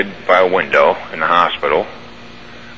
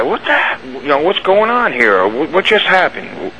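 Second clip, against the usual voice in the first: high, slow, bewildered mock yell; half-shouting much higher in disbelief, slowly; demanding answers over the radio in a higher, slower voice; retold yell at the guy; 6 semitones higher than usual. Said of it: "I started yelling at the guy".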